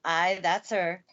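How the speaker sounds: slow, lightly voiced Yorkshire housekeeper; a hint of Yorkshire, drawn out; Yorkshire accent. Housekeeper answering the station master in a mild Yorkshire accent. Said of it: "speaking with a Yorkshire accent herself".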